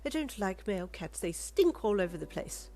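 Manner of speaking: snobby lady, mildly disgusted; disdain. Acted. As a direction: turn your nose up at the cat. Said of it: "Rita said with disdain".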